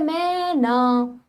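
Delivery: मैं ना said very slowly with a long stretched ai in मैं; drawn-out vowel. Shown as a demonstration. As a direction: hold the मैं vowel long to show the sound. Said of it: "तो मैं पे खींचने की आवाज आ रही है".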